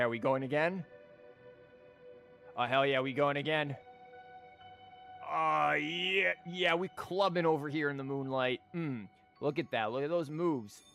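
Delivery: ordinary talk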